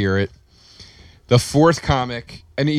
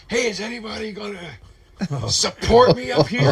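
much higher, strained plea to the back of the room; higher, stretched-out plea for support; pleading call-out; 9 semitones higher than usual. Retold or imitated. Pleading to comics from stage.

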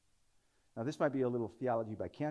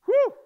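whew; 17 dB louder than usual. Real, not acted. Exclamation after climax.

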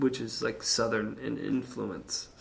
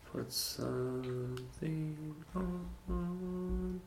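slow, soft, held-out words; drawn-out musing; 4 semitones higher, 10 dB quieter, much slower than usual. Pretend. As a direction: stretch it out softly, rising to a long high note. Musing aloud while picking a song.

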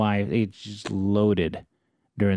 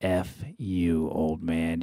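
low, softer private thought; inner grumble; 5 semitones lower, 5 dB quieter, much slower than usual. Retold or imitated. Homeowner silently cursing the neighbor, low and soft. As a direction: say it under your voice, low. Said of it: "I think to myself".

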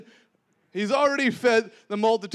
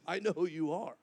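soft, lowered voice of dawning conviction; hushed confession; 3 semitones lower, 9 dB quieter than usual. Retold or imitated. Peter voicing his revelation to Jesus, hushed. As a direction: drop to a quiet, sincere confession.

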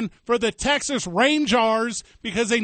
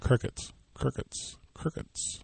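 each crickets softer, last ones whispered; fading to a whisper; 8 semitones lower, 11 dB quieter, slower than usual. Pretend. Host mocking fans' silence, fading to a whisper. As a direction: let crickets die away into a whisper.

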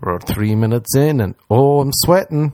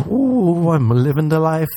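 ordinary talk

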